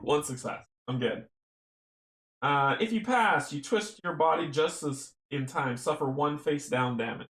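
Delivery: ordinary delivery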